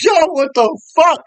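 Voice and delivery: high startled yell of disbelief; shocked shout. Retold or imitated. Cyclist yelling in shock after the bike crash. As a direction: blurt it out, high and loud. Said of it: "I was like".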